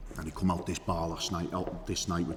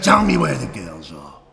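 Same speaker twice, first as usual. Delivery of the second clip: furious shout; 2 semitones higher, 12 dB louder, slower than usual. Retold or imitated. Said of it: "I said".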